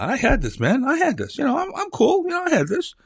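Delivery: put-on very high, breezy teen insisting he's cool; cocky teen son. Son denying to his dad that he was scared, high cocky teen voice. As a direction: play the kid pretending he was never scared.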